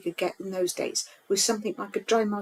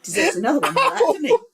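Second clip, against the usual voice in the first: teasing laugh; 6 semitones higher, 6 dB louder than usual. Real, not acted. Teasing partner after slip.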